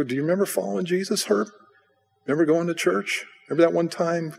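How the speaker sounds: high-pitched, eager reminder; eager reminiscing. Retold or imitated. Preacher reminding old friend of church days, eager reminiscing.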